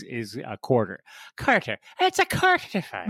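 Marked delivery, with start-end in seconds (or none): 1.3-3.1 very high put-on Irish lilt; hammed-up Irish, pitched way up; Irish accent. Shown as a demonstration. Co-host showing his trigger word, high Irish accent. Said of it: "for me, my Irish accent".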